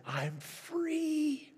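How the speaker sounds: lightly imitated tearful release, high and breaking; tearful cry. Preacher retelling a freed girl's words, mild high tearful cry. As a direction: echo her crying relief in a high, mild voice. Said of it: "she stood up and cried and said".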